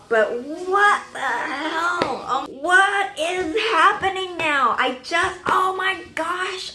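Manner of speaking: slow, high, drawn-out dismay; bewildered fan outburst; stunned disbelief. Real, not acted. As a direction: wail it out, stretching every word. Stunned by what is happening.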